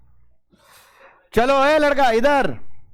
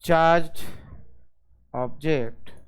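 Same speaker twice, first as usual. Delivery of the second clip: word by word, slow and falling quieter; slow dictation; 5 semitones lower, 6 dB quieter, much slower than usual. Acted. Teacher dictating a definition to students, slow and spaced. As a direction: dictate each word with long gaps.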